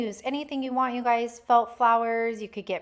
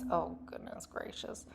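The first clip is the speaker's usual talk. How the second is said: whispered aside; 3 semitones lower, 16 dB quieter than usual. Real, not acted. Aside to herself mid-project.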